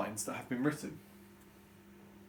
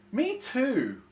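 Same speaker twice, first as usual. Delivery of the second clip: high, loud whine; sibling whining along; whiny child; 11 semitones higher, 6 dB louder than usual.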